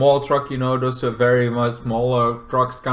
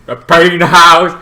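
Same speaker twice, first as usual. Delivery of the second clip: loud, slow and drawn out; theatrical announcement; 2 semitones higher, 10 dB louder, slower than usual. Acted. Vlogger naming the killer's lair, hammy announcement. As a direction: boom it out with hammy relish.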